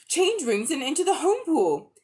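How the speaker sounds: high, loud hurried instruction; urgent. A narrator's reading. Polly urging Diggory to flee home, high and urgent. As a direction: push him to go now.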